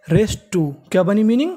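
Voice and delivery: normal talking voice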